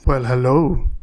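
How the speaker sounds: low, smooth seductive voice; sexy voice. Acted. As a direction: lay on the seductive charm. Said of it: "he's still doing his sexy voice".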